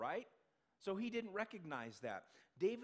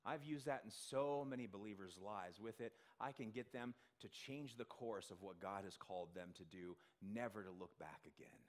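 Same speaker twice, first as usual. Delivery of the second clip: Satan; 6 semitones lower, 5 dB quieter than usual. Retold or imitated. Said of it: "Satan said".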